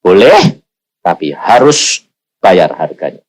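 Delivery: lightly voiced God, low and measured; God's voice. God warning the preacher he must pay the price, low and slow. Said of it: "Tuhan bilang".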